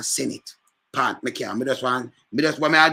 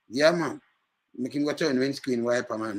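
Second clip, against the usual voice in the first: ordinary talk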